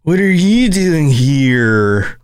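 put-on very slow, drawn-out California stoner accent. Host retries after the wrong accent, California accent. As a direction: stretch every word in a laid-back California accent. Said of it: "think about the Californians and be like".

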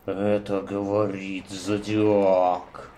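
slow, low menacing letter read; ominous killer voice. Shown as a demonstration. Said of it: "А, блядь, не так".

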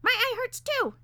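high, loud squeaky whine; piercing falsetto bird complaint; whining parakeet. A narrator's reading. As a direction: whine it as a sorry little bird. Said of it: "he whined".

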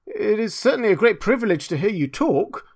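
high, lightly voiced humble admirer; admiring little Hans. A narrator's reading. Hans praising the miller's talk, mildly admiring. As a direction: offer a touch of earnest flattery.

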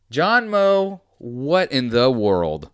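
loud, high, slow what in the world; baffled outburst at a ridiculous tweet; calling out the tweeter in loud disbelief; exasperated disbelief. Real, not acted.

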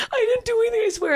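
high, loud wailing plea; sobbing plea. Retold or imitated. Girl crying in the back of the cop car, high sobbing plea. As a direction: beg through tears, voice cracking up high. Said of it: "I'm literally sobbing my eyes out".

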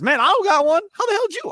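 jealous teammate voice, climbing high; Brooke Lopez. Pretend. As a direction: act out an indignant teammate. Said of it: "Or Brooke Lopez".

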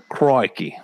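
lightly put-on Australian exclamation; a hint of mock Australian; Australian accent. Trotting out stock catchphrases, light mock Australian accent. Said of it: "That's Australian".

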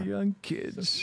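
put-on high, old-timer grumble; crotchety old-timer voice; old prospector. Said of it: "Back in my day".

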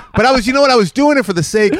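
high, loud laughing explanation. Real, not acted.

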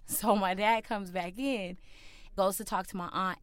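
usual speaking voice

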